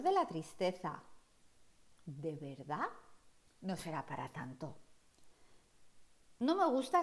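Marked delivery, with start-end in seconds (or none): 2.0-4.8 soft doubtful murmur. Acted. Doubting sadness of blue.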